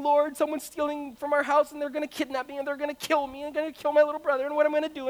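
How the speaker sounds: put-on high, frantic prayer of a scared kid; panicked crying prayer. Boy alone at night praying to God in tearful panic. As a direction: pray in a rushed, tearful panic. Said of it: "I'm just, like, crying, and I'm saying".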